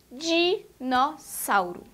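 "dinossauro" slowly split as di, nos, sauro; syllables separated. Shown as a demonstration. Teacher asking for the first syllable, syllables separated. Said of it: "qual é a primeira sílaba da palavra".

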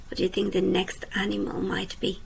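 ordinary delivery, nothing marked

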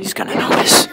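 breathy whisper, voiceless. Real, not acted. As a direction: whisper it like a secret warning. Predicts dad's trick fails.

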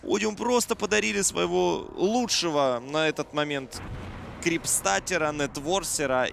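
high, loud, fast excited call; excited play-by-play. Real, not acted. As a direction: cast the wipe with rising hype. Caster reacts to a team wipe.

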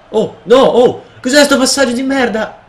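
high, loud exasperated protest; outraged yelling. Real, not acted. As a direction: yell in disbelief at a terrible pass. Curses a terrible pass.

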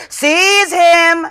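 high, loud order; commanding shout. Acted. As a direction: bark the order to seize. Master orders Joe seized.

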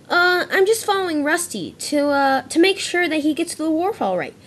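a hint of a fibbing engine; nervous excuse. Smudger tells the others why he's following Rusty, nervous fib. Smudger covers his lie.

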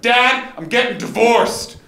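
high, loud, slow blurt; blurted shout. Retold or imitated. Son blurts to dad on the phone, high and loud. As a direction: blurt it out before he speaks.

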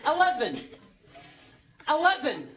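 ordinary talk, nothing marked

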